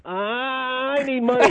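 high, drawn-out whiny son; hammed-up tearful begging. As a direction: whine it slowly like a spoiled grown son. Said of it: "One of the great acting experiences".

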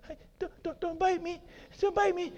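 high, soft frightened pleading; scared pleading. Acted. Timid person pleading with a dog, high soft fear. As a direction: beg the dog not to bite.

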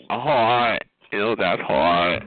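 slow, low drawl mimicking the caller; low, drawn-out mock catchphrase. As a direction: drag out "that's hot" in mockery. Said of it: "You keep saying hot".

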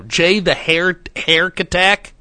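ordinary talk, nothing marked

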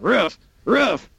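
high mock robot barking. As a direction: bark it out in a high, twangy robot voice. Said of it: "why does my dog sound like L?".

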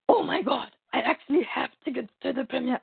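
lightly acted amazed joy; retold excitement. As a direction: replay the delighted realisation. Retelling her delighted reaction.

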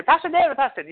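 high-pitched mimicked call for the pastor; youngsters calling. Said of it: "the youngsters, they, they all say".

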